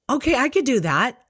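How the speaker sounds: high, quick, agreeable reply; suddenly willing daughter. Retold or imitated. Daughter sees the scanner and agrees, suddenly willing. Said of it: "Like, totally changed".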